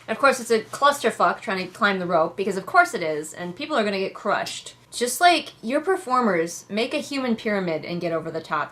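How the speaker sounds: usual speaking voice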